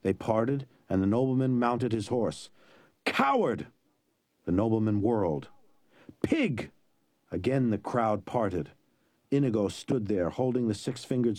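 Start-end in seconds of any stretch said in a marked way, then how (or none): 3.0-3.7 put-on high angry boy's cry of Coward!; child's shout. A narrator's reading. Young Inigo shouting Coward! at the nobleman. 6.2-6.7 high shouted insult; child's shout. A narrator's reading. Young Inigo hurling an insult at the nobleman.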